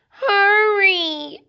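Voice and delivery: high, loud whining cry; crying piglets. Acted. Piglets pleading with their father, high crying whine. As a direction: piglets wailing at father to hurry.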